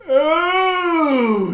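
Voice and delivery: high, loud, drawn-out delighted "Oh"; principal's delighted oh. Acted. Principal Mister Keene admiring his school, delighted exclamation. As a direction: swoon with pride over his school. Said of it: "he would say".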